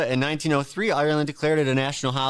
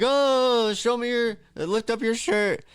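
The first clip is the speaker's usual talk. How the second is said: mock party shout; 10 semitones higher, slower than usual. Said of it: "you're like".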